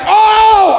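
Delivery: high, loud exasperated groan; mock groan. Imitating a tired person, loud high groan. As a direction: moan like you're always worn out. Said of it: "So if you're just like".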